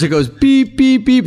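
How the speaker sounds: high, evenly spaced beep noises; imitated cue beeps. Comic retelling of looping cues, high imitated beeps.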